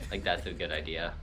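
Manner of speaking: usual speaking voice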